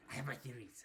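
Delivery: low, hushed repeat; conspiratorial aside. Acted. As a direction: drop it to a secretive murmur. Repeats line, sneaky low version.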